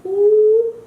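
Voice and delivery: high, loud, drawn-out "ooh" of mock wonder; showy "ooh". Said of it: "They come off as".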